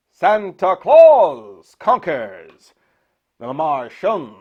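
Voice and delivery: slow, drawn-out title announcement; horror host announcer. Acted. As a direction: present the film title grandly, stretching it out.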